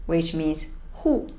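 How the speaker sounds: usual speaking voice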